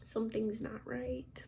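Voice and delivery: soft whisper, slowed. Retold or imitated. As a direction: hush it as if sensing trouble. Waking up feeling sick.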